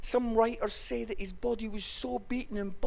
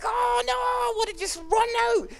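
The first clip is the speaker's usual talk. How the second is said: very high, loud comic wail; mock panic; 5 dB louder than usual. Student desperate to flee the wedding, high mock panic. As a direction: squeal it in hammed-up dread.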